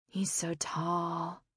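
slow breathy whisper; whispering in awe. A narrator's reading. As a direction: breathe it out, dazzled. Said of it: "Michelle whispered".

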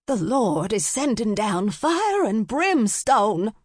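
high, slow wail of judgement; drawn-out, high doomsday cry; doomsday screaming. A narrator's reading. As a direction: holler it like the world is ending. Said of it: "began to scream between crashes of thunder".

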